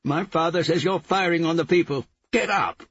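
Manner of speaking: high, furious shouted accusation; shouting. A narrator's reading. Gonja to the policeman about Gezi Park, shouting. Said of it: "Gonja shouted".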